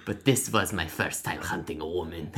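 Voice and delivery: low put-on Russian accent. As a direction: drop down into an amateur Russian accent. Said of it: "how to do a Russian accent".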